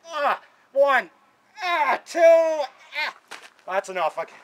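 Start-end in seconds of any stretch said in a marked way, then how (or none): 0.1-3.1 loud strained grunt on each count; real effort pushing out every count; straining grunts. Real, not acted. Straining through pull-up reps.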